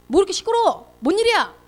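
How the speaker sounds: high, loud irritated question; annoyed demand. Acted. Elder son coming home to the party noise, irritated. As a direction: snap at the noise.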